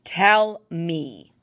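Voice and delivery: loud, curt order; loud angry command to a stubborn prisoner; glowering demand. Acted. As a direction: bark it at him, glaring. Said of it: "Tamara glowered".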